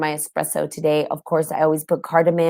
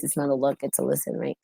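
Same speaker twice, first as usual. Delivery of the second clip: whispered aside; 2 semitones lower, 8 dB quieter, faster than usual. Real, not acted. Correcting look to listen.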